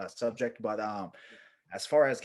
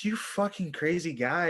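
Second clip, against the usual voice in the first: high, drawn-out exclamation; acted disbelief; 6 semitones higher than usual. Narrator as pilot walks off, acted disbelief. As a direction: marvel at the madman, retold.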